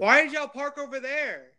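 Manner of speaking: high, loud exasperated whine. Real, not acted. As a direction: complain to the team in disbelief. Dying, frustrated at team.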